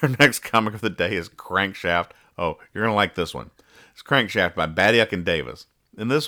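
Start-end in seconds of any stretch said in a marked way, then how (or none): none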